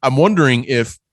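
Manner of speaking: chewing ice while talking; ice in mouth. Real, not acted. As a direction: talk around a mouthful of ice. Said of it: "while I chew this ice".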